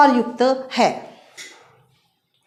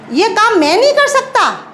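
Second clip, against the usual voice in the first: higher-pitched mock retort; acted protest; 7 semitones higher, faster than usual. Shown as a demonstration. Teacher acting a reply for pupils, higher protesting tone. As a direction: push back, pitch raised, in a demonstration.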